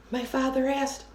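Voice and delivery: ordinary delivery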